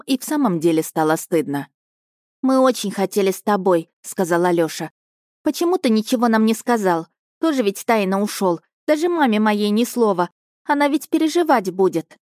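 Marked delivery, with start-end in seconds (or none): 2.4-12.2 put-on a small boy reporting eagerly to his captain; boy's voice. Nephew reporting to his uncle, higher boyish voice.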